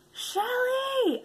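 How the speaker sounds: put-on high, piping little girl's call; child calling out. Goddaughter calling her aunt up the stairs, piping and high. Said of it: "and she goes".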